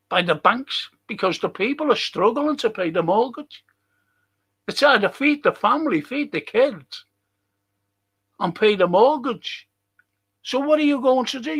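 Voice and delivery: ordinary talk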